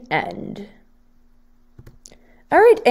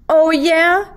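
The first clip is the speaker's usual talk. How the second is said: higher, louder challenging "Oh, yeah?"; cocky retort; 8 semitones higher, 7 dB louder than usual. Acted. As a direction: throw it back at him, daring. Monster challenges crying boy.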